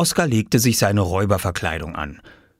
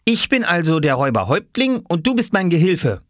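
higher-pitched laying down of rules; bossy child; 10 semitones higher than usual. Acted. Boy assigns robber roles to little brother, bossy child. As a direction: decree it like a boy in charge. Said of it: "bestimmte Oskar".

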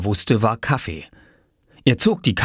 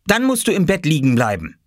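put-on higher-pitched boy's order; strict child ordering; 9 semitones higher than usual. Boy orders sick mother to stay in bed, strict. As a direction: lay down the law like a child doctor. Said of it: "ordnete Oskar mit strengem Gesicht an".

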